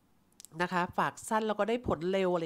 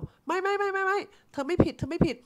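much higher, louder rush of no no no; flustered denial; 11 semitones higher, 5 dB louder than usual. Retold or imitated. Agent hurriedly reassuring the customer he did nothing wrong, flustered and high.

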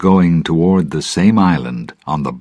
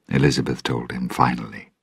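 usual speaking voice

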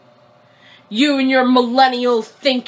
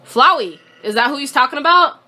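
normal talking voice

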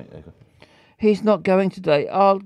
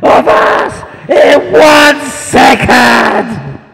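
shouting, half laughing; slow, loud disbelief; incredulous shout; 10 semitones higher, 12 dB louder, slower than usual. Real, not acted.

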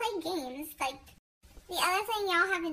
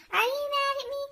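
high, slightly louder pleading mock voice; mock whiny question; 10 semitones higher, 4 dB louder than usual. Mocking followers asking if she's mad, high and whiny. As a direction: imitate a needy follower's whine. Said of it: "So don't ask me".

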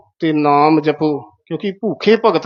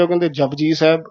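ordinary talk

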